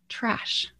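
breathless whisper on trash; whispered punchline. Real, not acted. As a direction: drop to a whisper for the reveal. Punchline about starting with trash.

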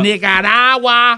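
slow, drawn-out booming volcano voice; rumbling erupting volcano voice. Pretend. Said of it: "That's my volcano voice".

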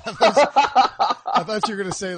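words through real laughter; laughing. Real, not acted. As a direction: laugh your way into the line. Laughing at a cut-off joke.

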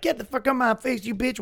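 high-pitched imitation of an angry yeller; mock yelling. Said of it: "starts yelling at her".

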